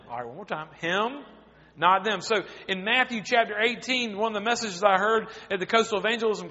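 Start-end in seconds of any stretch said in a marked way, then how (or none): none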